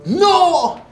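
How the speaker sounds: loud, high, drawn-out outburst; sudden shout. Acted. Guru denying that time exists, sudden shout. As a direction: explode on the word.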